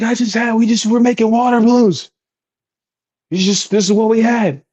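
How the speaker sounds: high-pitched mock pleading excuse; defensive excuse. Kid excusing condoms to his brother, high defensive voice.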